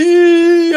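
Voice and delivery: high delighted squeal; excited squeal. Real, not acted. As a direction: squeal with glee. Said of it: "excited squeal over early game".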